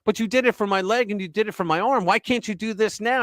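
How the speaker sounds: high-pitched mock complaint; pleading protest. Wounded pilot pleads with captors, high-pitched protest.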